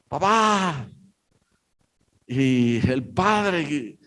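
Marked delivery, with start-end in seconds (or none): 0.1-0.9 a child calling out high and scared, lightly acted; mock frightened call, pitched up; child's cry for dad. Little boy up the mast calling his father, higher and scared.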